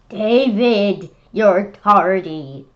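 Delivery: loud stern call, slow on tardy; scolding teacher. Acted. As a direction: tell him off loudly. Teacher scolding late David.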